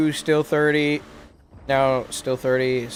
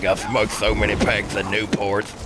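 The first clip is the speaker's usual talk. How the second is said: smoker voice; 6 semitones lower than usual. Pretend. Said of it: "you like my smoker voice".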